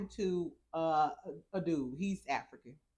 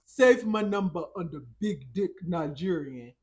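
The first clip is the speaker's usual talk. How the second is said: lightly voiced Nigerian accent, louder; Nigerian man impression; 4 dB louder than usual. Vlogger redoing the man's line in a mild Nigerian accent. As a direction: put on his accent properly this time. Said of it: "no, that ain't how he sound".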